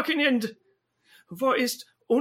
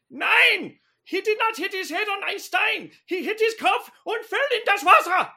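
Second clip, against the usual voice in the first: higher, exasperated German yelling; exasperated German shout; 7 semitones higher than usual. Acted. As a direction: shout it in frustration. Frustrated cat shouting at operator.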